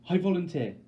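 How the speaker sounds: a high, loud, breathless I volunteer; gasped cry. Acted. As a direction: blurt it out before anyone stops you. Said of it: "I gasp".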